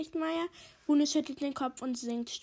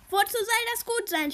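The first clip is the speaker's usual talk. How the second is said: higher, louder indignant complaint; scolding; 9 semitones higher, 7 dB louder than usual. A narrator's reading. Barbro bursts in complaining at being summoned, scolding. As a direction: scold them with raised voice and pitch. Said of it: "schimpft Barbro".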